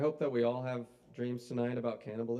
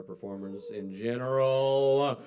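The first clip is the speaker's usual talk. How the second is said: loud, drawn-out emcee hype; hyping the crowd; 11 dB louder, slower than usual. Real, not acted. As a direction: stretch it out loud to whip up the room.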